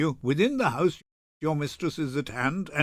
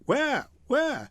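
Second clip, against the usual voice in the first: loud high halloo; excited high calling; hollering; 8 semitones higher, 4 dB louder, slower than usual. Acted. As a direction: shout it to the night. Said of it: "Leave hollowing".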